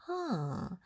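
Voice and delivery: ordinary delivery, nothing marked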